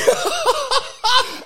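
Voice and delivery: loud squealing burst of real laughter; losing it completely; high, loud cackle of real laughter; high shrieking laugh. Real, not acted. Laughs at failed joke memory.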